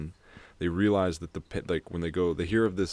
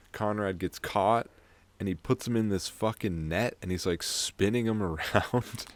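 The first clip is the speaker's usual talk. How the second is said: ordinary talk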